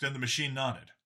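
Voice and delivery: ordinary delivery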